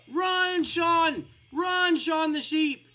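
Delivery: high, loud mock-panicked yell; urgent shout. Player urging Sean the sheep to escape, urgent shout. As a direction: yell a desperate warning.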